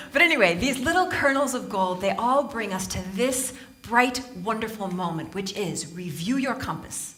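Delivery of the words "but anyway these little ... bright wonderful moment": usual speaking voice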